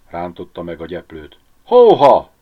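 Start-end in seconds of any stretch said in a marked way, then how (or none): 1.6-2.3 very loud, high horse call. A narrator's reading. Driver halting the horse Paták, calling out loudly. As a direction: holler it to stop the sleigh.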